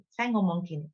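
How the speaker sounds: ordinary talk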